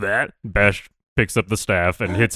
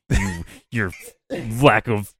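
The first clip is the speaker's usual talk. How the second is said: hammed-up fury building to a loud, higher finish; fuming; 5 semitones higher, 4 dB louder than usual. Bash fumes at the unfeeling dummy, mock fury building. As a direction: rage swelling as the line goes on. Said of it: "Fuming, you bash yourself".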